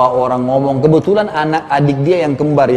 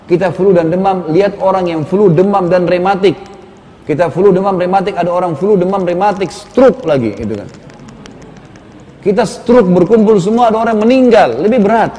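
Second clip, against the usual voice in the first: climbing pulpit fervor, slowing on the heaviest line; impassioned preaching; 5 semitones higher, 4 dB louder than usual. Acted. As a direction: preach it harder with each step. Builds comparison with rising fervor.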